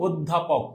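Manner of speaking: "অধ্যাপক" softly, opening on a rounded o; soft model "অধ্যাপক" with closed o; pronunciation demo. Shown as a demonstration. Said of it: "একবার উচ্চারণ করো তো আগে".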